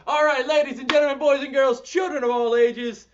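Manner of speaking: high, loud, fast ringmaster call; announcer voice. Acted. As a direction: announce it like a circus host. Ringmaster intro repeated loudly.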